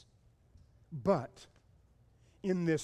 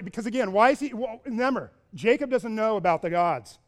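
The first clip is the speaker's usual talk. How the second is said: usual speaking voice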